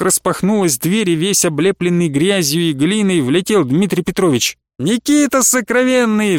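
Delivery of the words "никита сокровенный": joyful high cry of a name; exclaiming. A narrator's reading. Friend bursting in, greeting Merkulov with a joyful cry. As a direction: call out his friend's name in delight. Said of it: "вскричал он".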